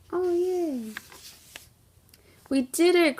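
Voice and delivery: high, loud cheer of joy; excited cry. Acted. Toad cheering to Frog as the kite climbs, thrilled. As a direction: cheer like a kid whose kite finally flies. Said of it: "cried Toad".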